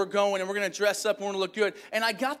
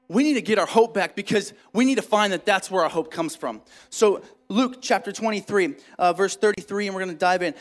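preaching; 6 dB louder than usual. Acted. Preaching build to scripture.

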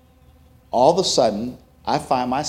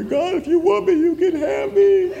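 sobbing prayer. Retold or imitated. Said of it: "I'm sobbing".

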